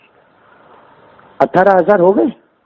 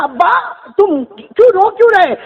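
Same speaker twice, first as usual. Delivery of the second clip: daughter's cry; 9 semitones higher than usual. Retold or imitated. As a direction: call out to the dying father. Daughter cries out to father. (بیٹی کی اونچی پکار)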